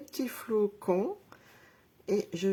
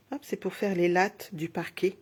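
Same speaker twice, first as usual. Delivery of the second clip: normal talking voice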